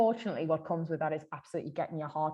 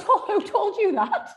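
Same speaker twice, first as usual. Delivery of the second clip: laughing while talking; 11 semitones higher, 4 dB louder than usual. Real, not acted. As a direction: giggle through it. Both laughing about TikTok advice.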